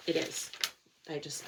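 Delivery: ordinary talk, nothing marked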